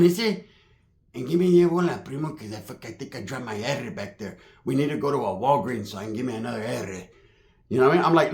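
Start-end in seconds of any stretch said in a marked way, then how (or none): 1.1-7.0 much softer and lower; subdued mumbled request. Retold or imitated. Battered Pepe asks his primo for help, quiet low mumbling. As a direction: imitate a beaten man quietly rambling. Imitating beaten Pepe asking favours.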